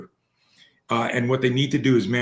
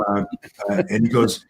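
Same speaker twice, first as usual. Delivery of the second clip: usual speaking voice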